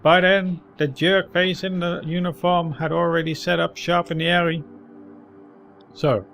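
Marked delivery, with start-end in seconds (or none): 0.0-4.6 put-on higher, louder grizzled old man. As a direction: ramble like a tired street veteran. Old man recounts his day.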